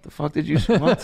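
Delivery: high, incredulous outburst; stunned disbelief. Retold or imitated. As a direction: sputter in shocked disbelief at grandpa. Retelling grandpa's remark, own reaction.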